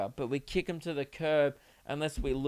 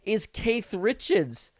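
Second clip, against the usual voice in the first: excited; 6 semitones higher, 4 dB louder than usual. Real, not acted. Excited rant over song.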